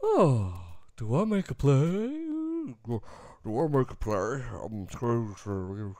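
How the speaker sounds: low, mumbling clueless coach voice; mock a lost coach muttering to himself; imitating a coach who's out of his depth; mimicking McCarthy. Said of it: "That's how he talks".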